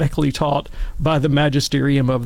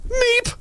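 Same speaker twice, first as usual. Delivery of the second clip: tiny very high-pitched "meep"; mock startled squeak of a frozen child; dumbfounded squeak. Stunned child after the teacher's rebuke, comic high squeak. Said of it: "dumbfounded with, like, a deer in the headlights".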